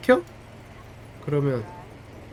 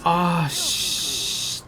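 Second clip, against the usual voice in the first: normal talking voice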